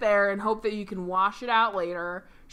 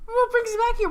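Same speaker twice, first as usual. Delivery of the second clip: high, loud employee's greeting; acted eager greeting; 12 semitones higher, 6 dB louder than usual. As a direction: call it out high to a customer. Debris boy greeting Sally, acted.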